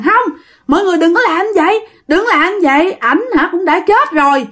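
high, pleading widow, not really screaming; mock wailing protest. A narrator's reading. Wife clings to the coffin to stop them, half-wailed protest. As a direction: protest in an acted high-pitched plea. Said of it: "Bắt đầu gào thét mà ôm cái hòm".

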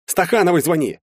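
high, urgent shout; alarmed command; barked order. A narrator's reading. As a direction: bark the order to phone. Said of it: "рявкнул я на сестру".

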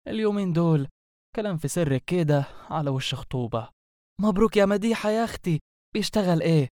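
put-on high, swooping neighbourhood-girl chatter; gossiping girlfriends. Two girls catching up on engagement news, gossipy chatter. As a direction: play two girlfriends gushing and nosy in dialect.

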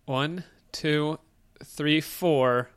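ordinary talk

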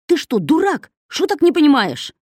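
high, loud indignant cry; outraged shriek. A narrator's reading. Kosoy shrieking at the camel, high and indignant.

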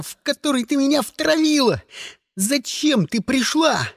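high-pitched, anguished tirade; strained, bitter outburst at the top of the range; yelled rant. A narrator's reading. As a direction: pour it out in a desperate rage. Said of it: "заорал Сиприт".

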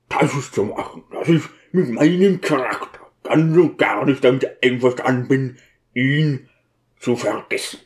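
gruff, halting character voice; defiant, mournful deacon; grieving deacon, stubborn. Acted. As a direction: refuse doggedly out of loyalty to the dead.

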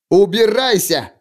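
крикнул, срываясь; shouted. A narrator's reading. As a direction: yell him away from you. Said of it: "крикнул принц".

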